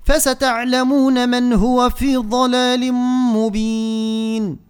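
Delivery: slow melodic tajweed chant; Quran recitation. Shown as a demonstration. Teacher chanting the verse for students, slow and melodic.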